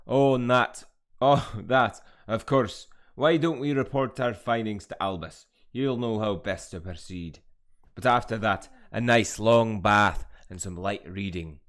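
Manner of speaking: put-on loud, hearty character accent; professor voice. Gamer voicing a Hogwarts professor, loud theatrical accent.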